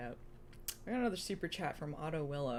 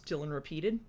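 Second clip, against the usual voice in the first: plain reading voice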